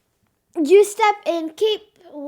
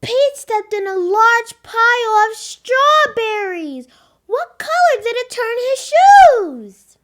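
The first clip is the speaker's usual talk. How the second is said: louder, higher storybook voice; lively read-aloud; 5 semitones higher, 8 dB louder than usual. Acted. Girl reads Pete the Cat to preschoolers. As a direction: read with big excitement for kids.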